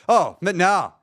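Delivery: normal talking voice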